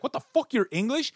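high-pitched incredulous outburst; shocked disbelief. Retold or imitated. Director finds out his American-sounding actor is British, mock shocked. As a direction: blurt it out high, mock stunned.

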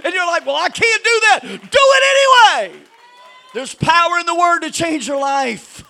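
rising, fiery preacher's cadence; preaching. Acted. Pastor preaching to his church about obeying the word, full force preaching. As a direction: preach it hard to the room, then let it settle.

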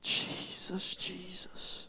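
barely audible whisper; whispered prayer. Real, not acted. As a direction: pray under your breath.

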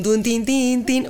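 hums the keys as playful syllables, rising on the last tin; sung piano notes. Retold or imitated. Telling the interviewer how she played by ear, playful sung notes. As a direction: sing out each note like a toy piano.

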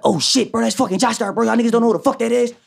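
high, excited mock yell of a friend; Ike hyped up. To Ja, friend spots a legend: high excited yelp. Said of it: "he like".

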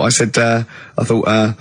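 ordinary talk, nothing marked

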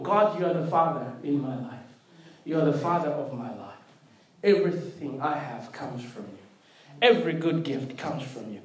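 soft, low, unhurried personal prayer; hushed, reverent acknowledgement of the Father; quiet prayer. Acted. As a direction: speak it low and intimately to God. Prayer spoken at home.

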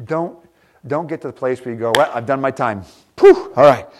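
usual speaking voice

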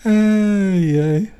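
usual speaking voice

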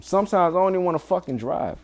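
ordinary talk

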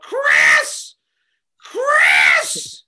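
shouted name, loud and strained; furious yell. Retold or imitated. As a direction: scream across the pool. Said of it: "She goes up".

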